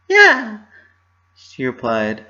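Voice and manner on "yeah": high, loud fake-bright yeah; forced cheer. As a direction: force the smile into it. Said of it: "forcing up a smile".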